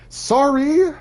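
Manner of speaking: very high, loud Canadian-style sorry; Canadian-accented apology. Pretend. Canadian excusing escaped flames, hammed-up high Canadian accent. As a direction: sing out a mock Canadian apology. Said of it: "with the very Canadian accent".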